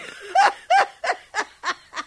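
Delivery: shrieking high laughter; high cackle. Acted. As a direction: dissolve into laughter. Nurse laughs after the story.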